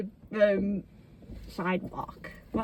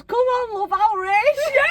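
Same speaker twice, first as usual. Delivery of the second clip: mock cheerful challenge; 8 semitones higher, 4 dB louder than usual. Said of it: "and she goes".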